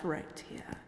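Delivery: hushed threat; menacing whisper. Acted. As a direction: drop to a breathy threat. Vampire threatens hunter.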